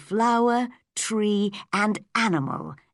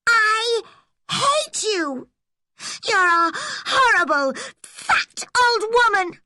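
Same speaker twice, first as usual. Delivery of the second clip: put-on much higher, louder screaming girl near tears; furious shout; 5 dB louder than usual. A narrator's reading. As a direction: scream it, voice cracking with rage. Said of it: "she shouted angrily".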